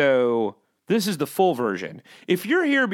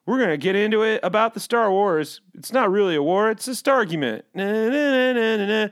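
lightly mocking Star Wars podcast host; podcast host impression; 6 semitones higher than usual. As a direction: open a nerdy podcast with fake energy. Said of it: "I could do something like".

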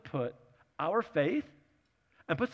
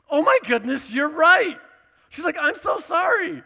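much higher and louder, gushing dismay; mortified apology; 11 semitones higher, 9 dB louder than usual. Retold or imitated. Mother in the car apologizing to her child, loud high dismay. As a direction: gasp it out, horrified at forgetting. Mom apologizes after realizing.

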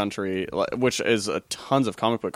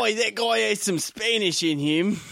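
a much higher, slower "Oi" version of a bad Spanish accent; failed Spanish accent; 11 semitones higher, slower than usual. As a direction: ham up a bad Spanish accent, slow and high. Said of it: "I don't even want you to do Spanish".

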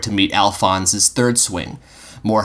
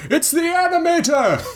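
high, far-off cry from the crowd; a militiaman shouting from across the bridge; distant cry. A narrator's reading. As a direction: call out from down the bridge in alarm. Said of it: "someone cried".

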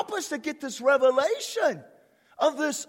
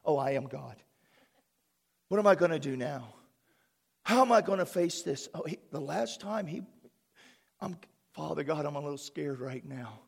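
small, meek, frightened voice, much softer and lower; mock scared Jesus; 9 semitones lower, 6 dB quieter than usual. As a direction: play a whimpering coward for laughs. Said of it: "looks at the devil and says".

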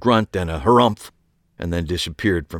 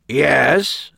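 higher, fed-up yes; exasperated; 6 semitones higher than usual. A narrator's reading. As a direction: sigh it out, out of patience. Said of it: "in an exasperated tone".

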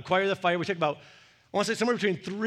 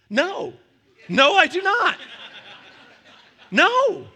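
higher and louder, a laughing, emphatic no; 10 semitones higher, 7 dB louder, slower than usual. Acted. Dad answering his kid's "don't you trust me" with a loud, laughing no. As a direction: refuse flatly, cracking up as you say it.

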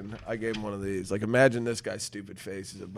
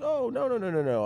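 higher, flustered little bird voice; the bird backpedals in a panic; mock panicky bird; 12 semitones higher than usual. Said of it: "And he's like".

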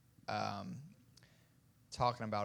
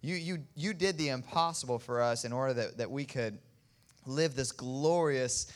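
rising, louder fervent prayer; preaching prayer; 3 semitones higher, 5 dB louder than usual. Acted. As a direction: pray with building fervour. Pastor leads closing prayer.

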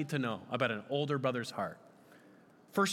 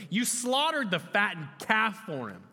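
higher-pitched indignant accusation; angry older son; 6 semitones higher, 3 dB louder than usual. Acted. Older son resenting the feast for his brother, angry. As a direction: throw it at your father as an accusation. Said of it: "Then he became angry".

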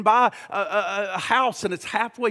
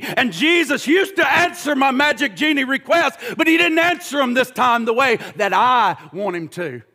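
loud, driving preacher's cadence; full-force preaching; 4 semitones higher, 7 dB louder than usual. Acted. Preacher to congregation about the treasure, full-force preaching.

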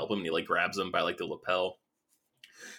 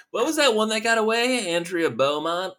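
high mocking taunt voice; 12 semitones higher than usual. Arthur Reeves needling Bruce about his lost love, high mocking taunt. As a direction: sneer the question up high. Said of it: "just talking all this shit about".

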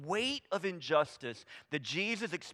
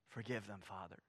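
much softer, lower, near whisper; hushed prayer; 10 semitones lower, 16 dB quieter than usual. Acted. Jesus praying from the cross, hushed. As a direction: breathe it out as a quiet plea.

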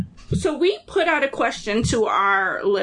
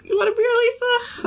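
a higher, put-on voice for a teasing offer; sing-song offer; 11 semitones higher than usual. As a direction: tempt her playfully with a higher voice. Offering Lisa a beer, playful.

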